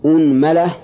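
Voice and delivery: "unmala", opening on u with a after the m; damma, then fatha. Shown as a demonstration. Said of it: "ضم الهمزة". (أنملة بضم الهمزة وفتح الميم)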